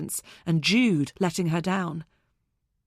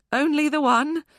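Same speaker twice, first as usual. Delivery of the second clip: forced upbeat; 8 semitones higher, 5 dB louder than usual. A narrator's reading. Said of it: "I was trying for upbeat".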